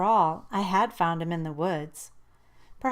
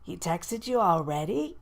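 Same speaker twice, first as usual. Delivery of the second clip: incredulous. A narrator's reading. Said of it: "Jane asked incredulously".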